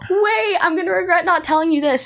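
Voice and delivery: high, breathless call to stop them; urgent plea. Retold or imitated. Fan chasing Becky to the door, high urgent plea. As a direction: blurt it out before they leave.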